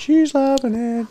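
put-on high, slow baby-talk voice; cooing about the dog, high and slow. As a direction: coo it like talking to a pet.

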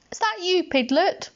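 high, rising question; questioning call. A narrator's reading. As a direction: call out lightly to check who's there. Pooh calls to noise at night.

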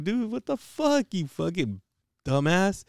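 high, exasperated retold rebuke; mad at brother. Sibling rebukes the brother who told mom, retold mad scolding. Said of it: "I was fucking mad at him too".

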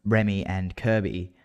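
usual speaking voice

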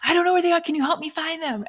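high, loud, flustered plea; mock panic. Wife imitating her husband losing his keys, high mock panic. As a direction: play him as a panicked husband. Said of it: "he freaks out and is like".

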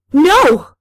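high, loud scream of refusal; sudden full-voiced cry. A narrator's reading. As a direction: scream it out in horror. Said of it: "I screamed".

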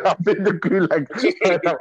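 drawn-out laugh through the words. Real, not acted. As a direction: laugh it out slowly. Laughing at being banned.

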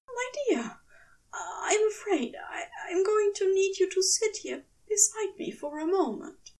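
trembling, high unvoiced whisper; shaken whisper. A narrator's reading. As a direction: breathe it out, barely holding together. Shaken after a nightmare.

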